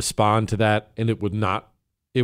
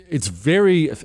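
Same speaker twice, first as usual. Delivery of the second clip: ordinary delivery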